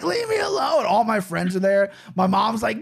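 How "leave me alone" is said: retold kid's yell. Twelve-year-old to his stepdad at a sleepover, high mock yell.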